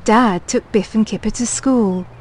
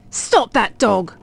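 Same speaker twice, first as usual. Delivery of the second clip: usual speaking voice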